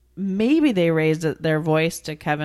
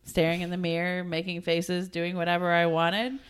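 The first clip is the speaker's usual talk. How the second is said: shrugging mumble; 7 dB quieter than usual. Retold or imitated. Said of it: "He goes".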